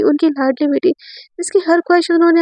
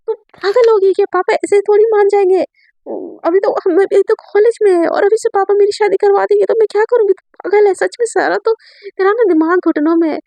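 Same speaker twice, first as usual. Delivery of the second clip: higher and louder, exasperated telling-off; angry scolding; 7 semitones higher, 3 dB louder than usual. Acted. Sandhya scolds Sara for telling her father, higher and louder, exasperated. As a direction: scold her friend like she's lost her mind.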